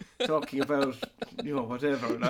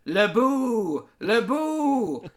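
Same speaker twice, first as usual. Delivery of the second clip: booing crowd; 6 semitones higher, 4 dB louder, much slower than usual. Retold or imitated. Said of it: "They'll all be going".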